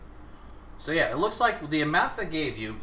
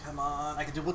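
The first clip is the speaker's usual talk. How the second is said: ordinary talk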